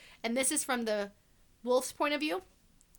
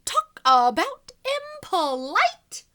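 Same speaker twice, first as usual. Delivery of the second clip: indignant; 8 semitones higher, 8 dB louder, much slower than usual. Acted. Wolf indignant.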